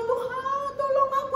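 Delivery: a hint of a weeping mother praying; tearful prayer. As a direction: beg God through mock tears. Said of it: "sampai nangis dia".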